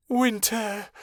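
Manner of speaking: high, tearful plea of his name; a touch of a sob, pleading with him; sobbing. Acted. Vera sobs at Winter over the dead wolves, tearful. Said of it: "she sobbed".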